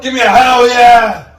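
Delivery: very loud, high Texas wrestler holler; Texas Stone Cold shout. Pretend. Rallying the crowd in a Texas accent, shouting. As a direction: belt out hell yeah like Stone Cold. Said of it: "Your best Texas accent".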